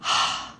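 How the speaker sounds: tired sigh at the end of a long day. Shown as a demonstration. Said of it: "Like at the end of the day".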